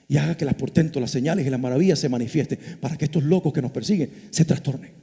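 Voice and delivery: predicación mucho más suave, casi confidencial; prédica íntima. Acted. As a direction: baja la voz y predica de cerca a la congregación. Prédica íntima en voz baja. (predicador que baja la voz para suplicar con intensidad)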